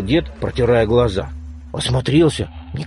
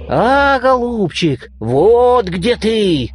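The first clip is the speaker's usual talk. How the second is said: bear roar; 6 semitones higher, much slower than usual. A narrator's reading. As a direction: growl it out slowly like a bear. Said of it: "заревел медведь".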